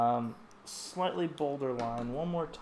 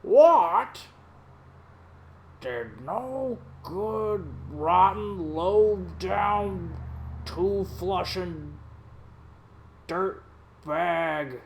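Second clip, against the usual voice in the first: Neil Hamburger voice; 3 dB louder, much slower than usual. Retold or imitated. Artist roasting [name] in Neil Hamburger's voice. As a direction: drag out each insult like a bitter old comic. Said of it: "my impersonation of Neil Hamburger".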